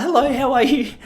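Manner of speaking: high, loud, stiff hello; awkward greeting. Shown as a demonstration. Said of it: "It wasn't that good".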